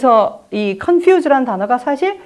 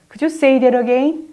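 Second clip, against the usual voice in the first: formal and slow; much slower than usual. Shown as a demonstration. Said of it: "라든가".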